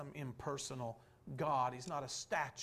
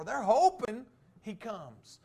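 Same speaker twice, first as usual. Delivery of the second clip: emphatic preaching; 7 semitones higher, 12 dB louder, slower than usual. Acted. Preacher stressing hope.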